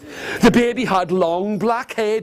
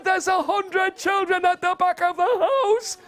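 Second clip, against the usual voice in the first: high-pitched squawk of disbelief; aghast; 10 semitones higher than usual. Acted. Stan confronting his wife about a hundred children, high aghast voice. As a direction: squeal it out, appalled.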